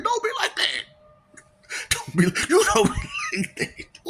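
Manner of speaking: squeaky high teasing plea; squeaky mock voice. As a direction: pout it in a silly high voice. Put-on high voice, laughing.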